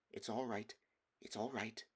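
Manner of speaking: softly telling himself it's fine; nervous self-reassurance. Acted. Edmund calming himself before the stone wolf, quiet. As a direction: hush your own fear under your breath. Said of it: "he kept saying to himself".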